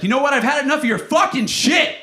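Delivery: screaming high and loud as the fed-up date; furious shout. Retold or imitated. Date screaming at the comedian over his cat. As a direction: blow up at him, shrieking high. Girlfriend blows up, acted out.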